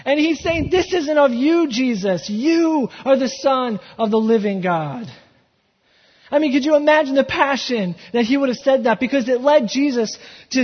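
preacher building passion before the congregation; full-force preaching. Acted. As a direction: preach it with fire.

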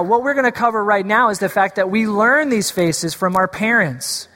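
normal talking voice

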